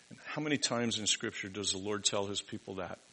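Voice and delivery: usual speaking voice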